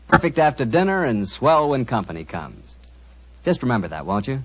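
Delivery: ordinary talk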